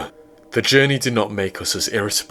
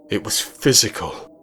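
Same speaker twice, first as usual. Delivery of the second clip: ordinary delivery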